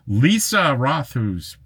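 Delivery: ordinary delivery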